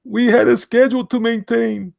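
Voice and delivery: high, loud put-on voice; comic high-pitched character; funny character voice. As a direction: pitch it way up and project. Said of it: "That guy sounds like this".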